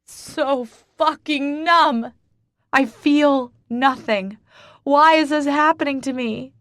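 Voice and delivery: slow, high, loud despairing wail; grief-stricken cry. Acted. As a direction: keep wailing. Wailing in despair.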